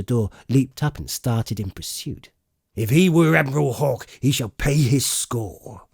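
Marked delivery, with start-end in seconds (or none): 2.7-5.9 angry cry. Acted. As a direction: bark that he shall pay. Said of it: "cried Silver".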